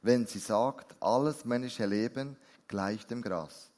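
emphatic, measured Bible reading; scripture reading. Acted. Preacher reads scripture to the congregation, emphatic and measured. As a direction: proclaim it slowly, leaning on key words.

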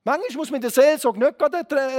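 ordinary talk

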